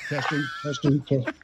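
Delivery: quiet megaphone mic check; megaphone turned down. Real, not acted. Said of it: "I got the volume way down".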